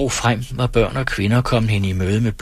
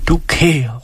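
high, louder cry of delight; delighted call; 6 semitones higher, 4 dB louder than usual. Acted. Villagers greet the rider, high and loud. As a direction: call out in joy. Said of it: "råbte de".

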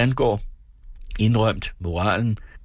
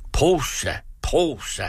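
readers crying out; 6 semitones higher, slower than usual. Retold or imitated. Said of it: "råber læserne".

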